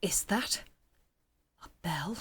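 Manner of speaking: soft, half-whispered wondering; quiet, suspenseful musing; hushed character voice. Acted. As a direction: murmur it softly, listening out. Voices game character hearing a sound.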